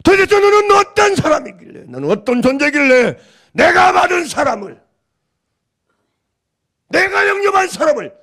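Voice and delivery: God rebuking the judging believer; indignant rebuke. Retold or imitated. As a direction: rebuke with fire. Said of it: "이 물음이에요".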